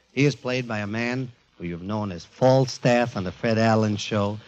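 ordinary talk

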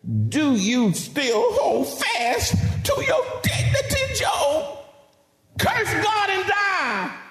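high, slow, scornful wife's cry; hammed-up wife lashing out at her husband; Job's angry wife. Job's wife turning on her husband, angry wife voice. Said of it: "Then his wife, Missus Job, said to him".

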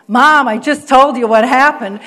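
acted teen impatience, pushed out loudly; exasperated daughter. Daughter protests mother's repeated question.

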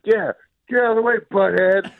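very high, quick mock yell; high-pitched road-rage imitation; retold driver's yell. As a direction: holler it at a car in comic outrage. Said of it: "I like to yell at drivers".

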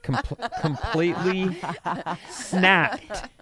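laughing through the words. Real, not acted. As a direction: giggle while saying it. Laughs while reading news.

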